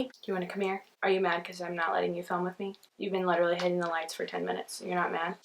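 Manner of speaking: soft murmur aimed at the pet; hushed talk to dog. Real, not acted. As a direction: drop to a hushed aside for the dog. Talking softly to the dog.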